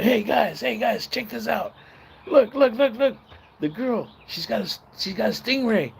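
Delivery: rally the group to look; excited call-out. Retold or imitated. Calling friends over excitedly.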